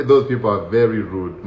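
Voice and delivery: ordinary delivery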